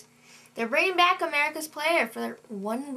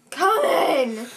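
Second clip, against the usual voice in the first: loud, higher yell to the door; mock irritated holler; exasperated shout; 4 semitones higher, 6 dB louder than usual. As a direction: shout it, fed up with the ringing. Exasperated answer to repeated ringing.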